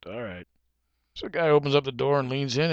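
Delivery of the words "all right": low, quiet giving-in; reluctant agreement. Acted. Shopper giving in to pushy salesman, low and reluctant. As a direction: give in softly and reluctantly.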